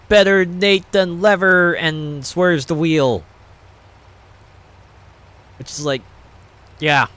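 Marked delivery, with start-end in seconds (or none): 0.1-1.7 high, loud, slowed-down punchline; high, loud, slow delivery of the pun; punchline exclamation. Acted. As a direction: land the pun, big and drawn out.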